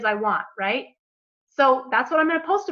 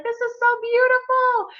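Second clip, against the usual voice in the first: high, dreamy, delighted voice; blissful future self; 9 semitones higher than usual. Pretend. Imagined relaxed self savoring the snorkel, high and blissful. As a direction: float up into pure contentment. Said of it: "She'd be like".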